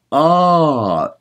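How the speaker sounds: ah sung like a yawn, lifted; a coach showing the yawn space on ah. Shown as a demonstration. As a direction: open into a yawn on ah. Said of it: "Imagine you're yawning".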